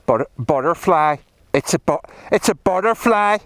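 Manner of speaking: lilting mock Northern Irish accent. Rider finding the word romantic, attempted Northern Irish accent. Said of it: "as they say in Northern Ireland".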